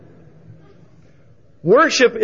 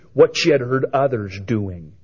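normal talking voice

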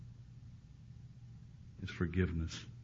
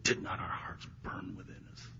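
whispered in awe, barely voiced; hushed whisper; 7 dB quieter than usual. Disciples recalling the walk to Emmaus, whispered. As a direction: breathe it like a remembered secret.

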